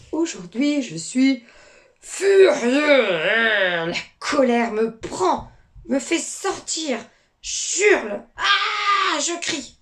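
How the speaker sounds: loud angry outburst rising to a cry; furious fish. Pretend. Teacher reading to pupils, the furious fish shouting. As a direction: let the anger burst out.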